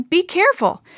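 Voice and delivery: high-pitched urgent caution; yelled warning. A narrator's reading. Girl warns her friends about the porch, calling out. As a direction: call out a warning to the group. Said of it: "she yelled to all of them".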